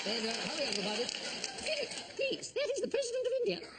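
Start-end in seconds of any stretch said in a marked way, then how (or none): 1.1-3.7 much higher, hushed warning to her husband; high scolding wife. Acted. Wife correcting Philip in front of guests, high scolding voice. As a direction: hiss a correction at an embarrassing husband.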